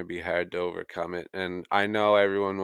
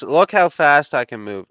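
excited; 7 semitones higher, 10 dB louder than usual. Acted. Showing off being pumped.

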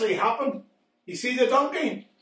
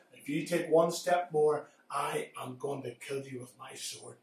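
quiet menace; 9 semitones lower, 9 dB quieter than usual. Acted. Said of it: "the angel says".

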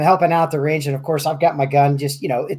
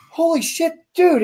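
high retold shout of alarm; a pitched-up startled curse; shocked exclamation; 10 semitones higher, slower than usual. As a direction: blurt it out in alarmed disbelief. Retold shock at gun grab.

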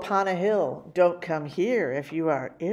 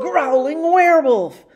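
loud growl; 9 semitones higher, 8 dB louder than usual. A narrator's reading. Werewolf reveal, growled.

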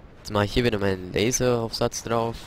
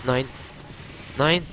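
panicking gamer; 3 semitones higher than usual. Real, not acted. Frozen by monster, panicking.

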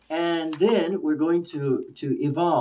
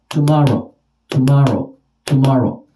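"tomorrow" loud and falling, each separate; beaten-out syllables; 8 dB louder than usual. Shown as a demonstration. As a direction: beat out "tomorrow" with a strong middle syllable. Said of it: "they can beat it out".

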